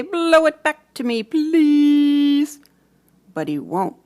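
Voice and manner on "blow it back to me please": high, loud plea up to the tree; pleading call. Acted. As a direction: beg the wind for the balloon. Child pleading with wind.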